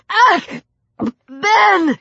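high, choked cry; strained wheeze. Acted. Heroine to her attacker as he chokes her, strained wheeze. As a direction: cry out as if throttled. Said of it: "she wheezed as she stumbled".

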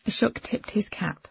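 ordinary delivery, nothing marked

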